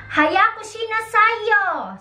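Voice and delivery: high, slow mock-mom urging; mother urging her child. Mother telling her child to hurry up. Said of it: "nanay ulit, sasabihin ko sa anak ko".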